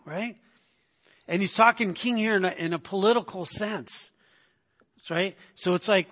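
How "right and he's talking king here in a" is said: ordinary delivery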